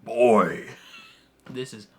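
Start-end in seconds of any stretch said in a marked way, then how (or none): 0.0-0.7 deep, booming shout; Kratos. Shown as a demonstration. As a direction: boom it out low and very loud. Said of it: "a deep booming".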